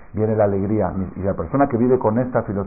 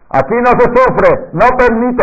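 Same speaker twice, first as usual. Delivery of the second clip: far higher and louder, slow, forbidding; commanding midwife; 12 semitones higher, 6 dB louder than usual. Retold or imitated. Anciana partera ordena en la sala, muy alto y fuerte. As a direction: forbid suffering in her room. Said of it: "así dijo".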